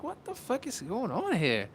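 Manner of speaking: high-pitched incredulous question; bewildered reaction. Retold or imitated. Reacting to what happens on Instagram, bewildered. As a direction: ask it high, in disbelief. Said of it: "I'm like".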